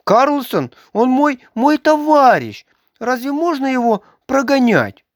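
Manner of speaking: put-on a touch of tears in the boy's plea; tearful plea. A narrator's reading. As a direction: beg for his friend, near tears. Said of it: "со слезами в голосе".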